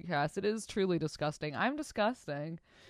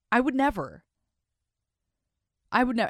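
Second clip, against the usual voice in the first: high, loud I would never; emphatic never; 6 semitones higher, 6 dB louder, faster than usual. Real, not acted. Host reacting to the story, loudly refusing.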